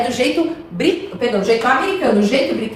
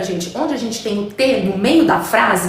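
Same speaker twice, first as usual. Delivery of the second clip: ordinary delivery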